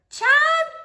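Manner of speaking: put-on high drawn-out "Child"; sing-song "Child" from an old lady; Gloria's high call. Gloria voiced in read story.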